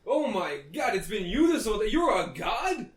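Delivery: high, startled disbelief; awestruck shock. Acted. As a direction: gasp it out in awe. NPC realises players are gods.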